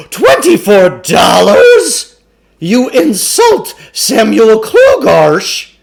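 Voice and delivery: slow, loud, high indignant bellow; pompous offended roar; mock-outraged shout. A narrator's reading. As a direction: play the wounded showman, loud and slow. Huckster offended by low offer.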